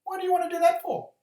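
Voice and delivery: very high, loud, quick lizard voice; high cartoon lizard, quick and loud; lizard character. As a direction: squeak it out fast as a little lizard. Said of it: "He goes".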